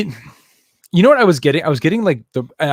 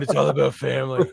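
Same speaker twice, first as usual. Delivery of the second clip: gruff mock Vin Diesel growl; grumpy pirate; 3 semitones higher than usual. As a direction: do a grumbly tough-guy voice. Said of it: "I just sound like a grumpy pirate".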